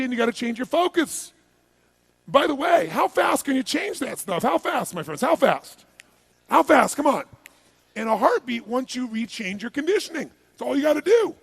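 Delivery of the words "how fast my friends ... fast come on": loud, insistent prompt; rallying demand. Real, not acted. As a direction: push the crowd hard for an answer. Prodding the crowd to answer.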